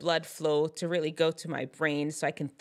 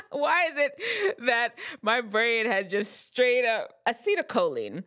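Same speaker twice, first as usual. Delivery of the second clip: exasperated blank-out; 5 semitones higher than usual. Real, not acted. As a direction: stall in mock despair at your own brain.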